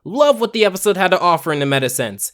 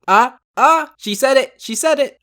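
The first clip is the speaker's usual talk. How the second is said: excited; 7 semitones higher than usual. Real, not acted. Excited at catchphrase.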